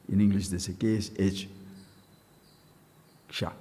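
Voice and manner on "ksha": usual speaking voice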